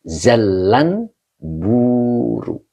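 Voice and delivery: "Zallan buru" very slow, with a doubled l in "Zallan"; teacher modelling "Zallan" with a lengthened l; slow demo reading. Shown as a demonstration. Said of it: "Jadi lamnya pakai tasdid".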